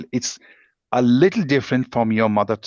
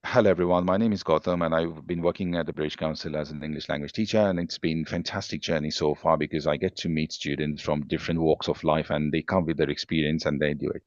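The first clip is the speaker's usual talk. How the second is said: no pauses, rushed; 6 semitones lower, 5 dB quieter, faster than usual. Shown as a demonstration. Teacher demonstrating speech without pauses. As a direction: run it all together without pausing. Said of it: "This is one way".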